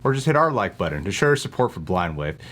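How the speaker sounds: ordinary talk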